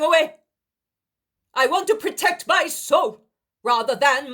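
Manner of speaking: high, loud firm rebuke; defiant farewell. Acted. Daniel renouncing Helkai, loud high defiance. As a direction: stand up and leave.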